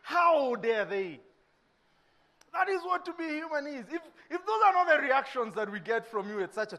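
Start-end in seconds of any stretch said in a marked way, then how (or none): 0.0-1.2 slow, indignant how dare they. Retold or imitated. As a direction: drag it out in outrage. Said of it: "and you".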